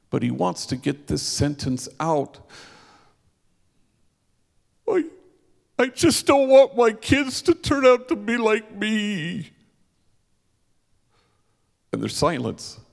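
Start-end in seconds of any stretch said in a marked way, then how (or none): 4.9-9.5 high, loud, tearful voice breaking; Mario confesses to the crowd, much higher and tearful; crying confession. Retold or imitated. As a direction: push the words out through tears. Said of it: "he's clearly crying".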